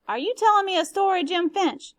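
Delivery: a touch of stern suspicion; mildly hardened, questioning Jim. Acted. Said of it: "Calpurnia's voice hardened".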